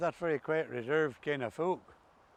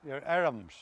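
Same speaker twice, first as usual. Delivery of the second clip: ordinary talk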